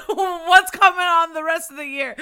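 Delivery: high, loud laughing cry of disbelief; squealing with laughter at the year. Retold or imitated. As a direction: laugh it out in mock panic. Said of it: "I'm like".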